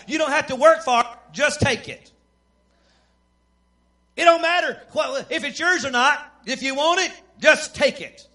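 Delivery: fired-up preacher delivery; full-force preaching. Real, not acted. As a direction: drive the point home from the pulpit.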